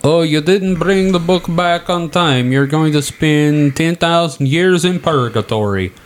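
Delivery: high, scolding clerk voice; stern librarian voice. Pretend. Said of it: "voicing a stern librarian".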